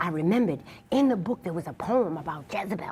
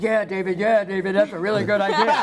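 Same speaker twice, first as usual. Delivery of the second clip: louder, gushing yes-man voice; mock eager agreement; 3 semitones higher, 6 dB louder than usual. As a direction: imitate colleagues fawning over the idea. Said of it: "everybody's like".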